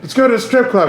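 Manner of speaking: high-pitched mock drunk party guy; trashed club owner. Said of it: "like, trash".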